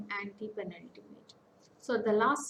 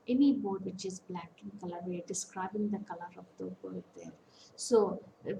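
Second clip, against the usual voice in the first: normal talking voice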